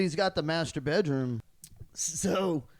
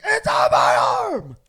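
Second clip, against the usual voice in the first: loud slow shout; retold shout; 2 semitones higher, 15 dB louder, slower than usual. Brother yells about puke, loud slow shout. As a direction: bellow it, outraged. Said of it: "He's like".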